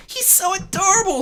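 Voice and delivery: high, delighted cry; happy gush. Acted. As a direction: squeal it with delight. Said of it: "she cried happily".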